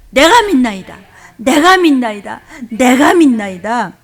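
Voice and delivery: loud, repeated cry; loud declaration. Shown as a demonstration. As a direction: call it out three times with conviction. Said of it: "우리 세 번만 시작".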